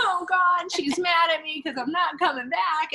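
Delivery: high, loud, dramatized panic; anxious panic. Retold or imitated. Retelling fear that her friend is mad, mock panic. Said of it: "My anxiety got the best of me".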